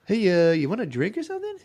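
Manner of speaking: high silly clown voice; goofy creepy party host. Retold or imitated. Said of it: "Hit you with clown voice".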